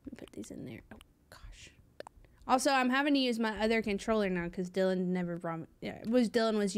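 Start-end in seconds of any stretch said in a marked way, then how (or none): 0.1-1.7 whisper. Acted. Muttered while sorting items.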